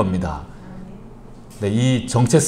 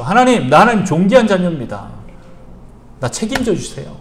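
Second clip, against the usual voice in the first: a playful hint of a threat; half-joking demand of God; mild threat; 6 semitones higher than usual. Shown as a demonstration. Pastor demonstrates half-jokingly pressing God, mild mock threat. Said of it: "약간 협박을 하면서".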